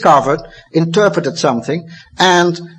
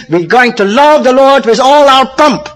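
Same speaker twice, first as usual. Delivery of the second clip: loud, higher, ironic pronouncement; mock proclamation; 8 semitones higher, 7 dB louder than usual. Preacher mocking modern view, loud ironic proclamation. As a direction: say it as if absurdly serious.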